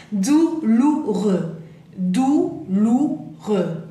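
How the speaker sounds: "Douloureux" very slow, dou-lou-reux; syllable by syllable. Shown as a demonstration. Teacher drilling a difficult word, very slow syllables. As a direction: separate and stretch each syllable of "Douloureux".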